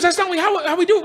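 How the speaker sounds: high, flustered scolding; acted indignant telling-off; exasperated trainer. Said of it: "the trainer loses his shit".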